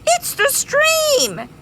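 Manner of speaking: very high, bright squeal; excited bee. Acted. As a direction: burst with excitement.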